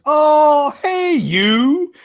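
high, loud innkeeper's cheery call; innkeeper greeting. Acted. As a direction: call out brightly as the barmaid. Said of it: "And she goes".

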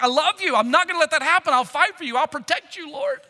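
loud, high, ardent pledge; fervently protective. Acted. Peter vowing to fight for Jesus, fervently protective. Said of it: "responding out of pure love and protective".

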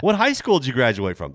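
loud, high excited question; eager classmate. Retold or imitated. Classmate quizzing a supposed hometown peer, loud and eager. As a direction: imitate a girl blurting it out loudly.

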